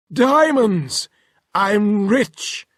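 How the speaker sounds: high, loud joyful cry; thrilled at the diamonds; delighted professor. Acted.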